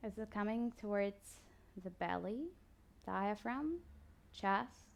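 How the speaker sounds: soft, slow body-scan guidance with long pauses; guided meditation. Acted. Yoga teacher guiding listeners through a body scan, calm meditation voice. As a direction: ease the listener into stillness, unhurried.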